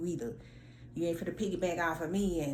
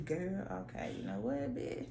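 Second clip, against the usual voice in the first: whispered insult; muttered under breath; 7 dB quieter, slower than usual. Retold or imitated. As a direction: hiss it under your breath. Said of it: "I'm like".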